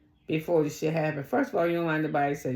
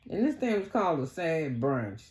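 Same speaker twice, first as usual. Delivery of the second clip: normal talking voice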